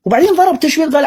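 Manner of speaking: normal talking voice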